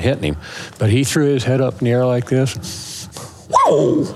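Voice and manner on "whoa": elk warning bark. Retold or imitated. Hunter imitating the bull's warning bark, sharp high bark.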